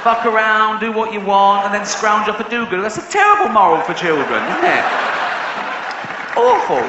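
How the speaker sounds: shrill outraged rant at the audience; indignant rant. Real, not acted. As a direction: rail against the moral in disbelief. Ranting about the story's moral.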